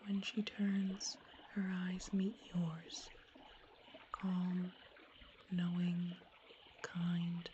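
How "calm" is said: ordinary delivery, nothing marked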